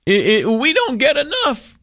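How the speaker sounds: high, put-on whiny voice; mock whine. Mocking the unions, a high whiny complaint. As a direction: whine like a greedy union boss.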